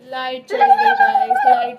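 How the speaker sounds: real shock when the power goes; surprised shriek; startled cry. Real, not acted. Power cut announced.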